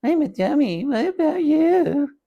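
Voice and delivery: very high squeaky dummy voice; boy working the dummy in a tiny voice. Acted. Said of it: "in a tiny voice".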